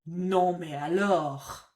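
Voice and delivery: slow, drawn-out exasperation; fed up, come on really; exasperated. Shown as a demonstration. Teacher showing exasperation to her learners, fed up. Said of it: "it's that exasperation".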